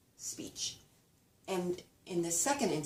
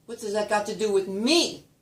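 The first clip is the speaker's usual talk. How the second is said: loud, higher, "me" punched at the end; stress on "me"; 4 semitones higher, 9 dB louder than usual. Shown as a demonstration. Somebody answering a question, stress on "me". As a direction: throw the weight onto "me". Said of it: "The stressed word".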